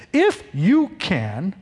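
slow, stretched-out echo with a rising end; taken-aback repeat, each word drawn out; incredulous reaction. Acted. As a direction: hand the doubt back word by word. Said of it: "he reacts".

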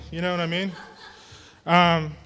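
normal talking voice

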